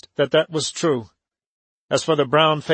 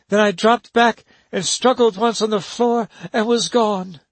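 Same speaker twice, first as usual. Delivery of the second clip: put-on higher, urgent young woman; urgent instructions; 7 semitones higher than usual. A narrator's reading. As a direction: press her to hurry. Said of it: "Helen explains her plan".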